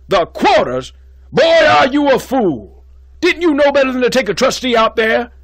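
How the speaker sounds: loud, high, contemptuous rage; furious yell. Acted. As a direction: tear into the boy. Dean berates student.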